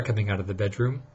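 ordinary delivery

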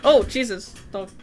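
usual speaking voice

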